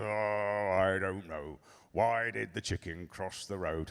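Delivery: low, quiet, weary play-along; indulgent dad. Retold or imitated. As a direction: humour the child with tired patience. Dad indulges daughter's tedious joke.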